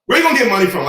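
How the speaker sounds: put-on high, loud teasing girl voice; mocking older girl. Older girl teasing the young boy, high and mocking. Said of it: "she walks out saying".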